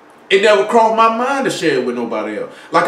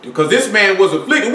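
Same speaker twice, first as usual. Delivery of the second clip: normal talking voice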